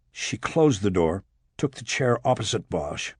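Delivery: ordinary delivery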